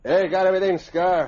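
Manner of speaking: loud, high call across the room. Acted. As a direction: shout over to his partner. Boss calls across cabin.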